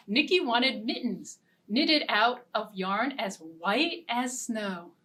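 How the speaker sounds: ordinary delivery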